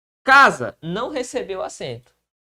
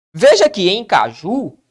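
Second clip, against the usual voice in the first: ordinary talk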